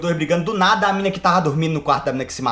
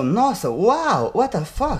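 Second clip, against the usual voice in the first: stunned amazement; 2 semitones higher, much slower than usual. Pretend. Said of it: "E as pessoas pensam".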